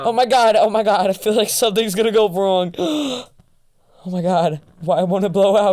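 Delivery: panicked loud oh my gods; yelling in a frightened rush; screaming. Real, not acted. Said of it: "You're screaming".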